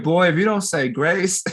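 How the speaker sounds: a mother's stern warning, loud and high; threatening mom voice. Retold or imitated. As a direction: scold the boy like his mother would. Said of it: "And she was like".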